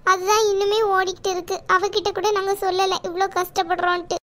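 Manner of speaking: ordinary delivery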